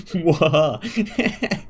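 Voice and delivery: slow, loud, hammed-up evil cackle; evil villain laugh. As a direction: drag out a big panto villain laugh. Villain laugh, bigger take.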